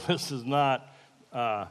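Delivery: ordinary talk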